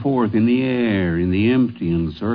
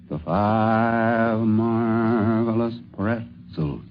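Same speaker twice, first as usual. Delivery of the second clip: very slow, drawn-out circus announcer call; megaphone announcer; 4 dB quieter, much slower than usual. Acted. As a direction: stretch every word like a ringmaster over a crowd.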